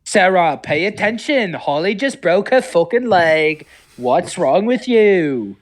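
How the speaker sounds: scolding friend. Pretend. Voiced character scolding Sarah.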